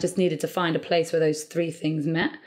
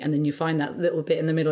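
usual speaking voice

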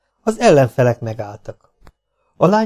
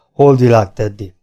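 ordinary delivery, nothing marked

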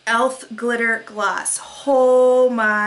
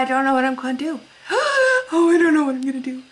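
mock crying; 3 semitones higher, 3 dB louder, much faster than usual. Mock crying over choices.

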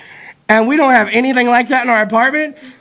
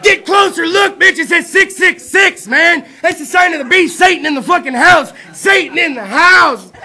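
louder mock yell at the girlfriend, slow on six six six; 6 semitones higher, 5 dB louder than usual. Comic yelling at his blind girlfriend to read the microwave, loud mock yell. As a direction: shout it at her like the devil is in the kitchen.

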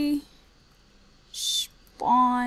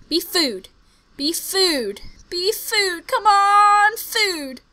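high loud begging; real eager plea; pleading excited; 7 semitones higher, 8 dB louder, much slower than usual. Real, not acted. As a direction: beg the chest to be food. Begging chest to be food.

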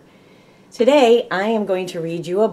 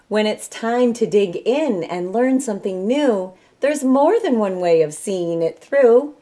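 lively, higher sing-song storybook read; bright rhyming picture-book reading; reading to children; 4 semitones higher, 6 dB louder than usual. Acted. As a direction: read it like story time for a class.

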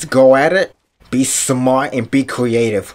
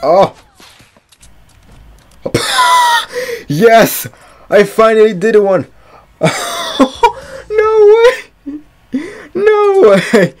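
ecstatic celebration; 6 dB louder, slower than usual. Real, not acted. Finally wins a fight, ecstatic.

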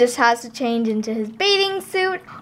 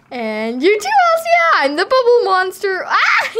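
playful monster; 8 semitones higher, 4 dB louder than usual. Pretend. Playing bubble monster.

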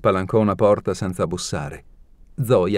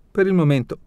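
impudent, much higher sing-song reply; cheeky; 9 semitones higher than usual. A narrator's reading. Alina to jealous Zoya, impertinent reply. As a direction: toss it off cheekily, pitched way up. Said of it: "in tono impertinente".

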